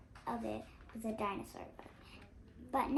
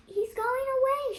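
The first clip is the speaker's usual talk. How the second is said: panting; 12 semitones higher, 10 dB louder than usual. Acted. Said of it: "she said, panting".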